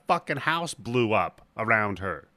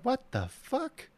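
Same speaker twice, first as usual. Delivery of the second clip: much higher, stunned what the fuck; startled squeak of disbelief, much higher; shocked disbelief; 8 semitones higher than usual. Retold or imitated. Everyone sees the floating lightning.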